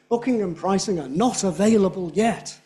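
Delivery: every word of "not available yet" stressed hard; emphatic full stress. Shown as a demonstration. Teacher demonstrating too many stresses to a class, emphatic. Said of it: "If you stress all of them, it's emphatic".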